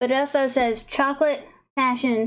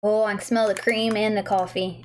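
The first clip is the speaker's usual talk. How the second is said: ordinary delivery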